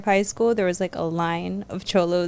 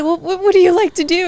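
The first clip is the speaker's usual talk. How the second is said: higher, louder kind counselor voice; mock counselor; 9 semitones higher, 7 dB louder than usual. Student voicing the counselor who met her crying. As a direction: imitate the gentle counselor asking. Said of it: "she was just like".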